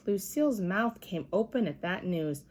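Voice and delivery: ordinary delivery, nothing marked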